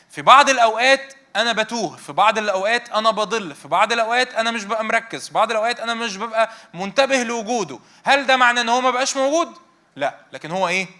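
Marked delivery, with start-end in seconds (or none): 0.1-9.5 louder and higher, each في بعض الأوقات hit hard; preaching at full force. Acted. Preacher confessing straying before the congregation, full fervent force. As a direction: drive the list home like a revival preacher.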